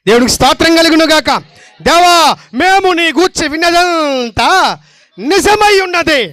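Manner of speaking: high, loud, ringing declaration of truth; fervent preaching. Acted. Preacher to the congregation, fervent loud preaching. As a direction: proclaim it to the whole church.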